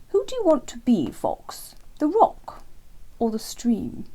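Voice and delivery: a hint of an eager old hare; Hare's voice. Hare voiced, earnest question.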